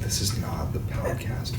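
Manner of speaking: soft unvoiced aside; whispering. Real, not acted. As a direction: whisper it under your breath. Aside during partner's talk.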